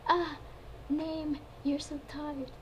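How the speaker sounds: high, loud hammy cry; hammy stage actor. Acted. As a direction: overplay the cry. Poland cries out in pleasure.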